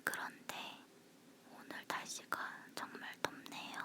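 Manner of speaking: breathy whisper close to the mic. Real, not acted. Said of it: "속닥거리는 목소리라서".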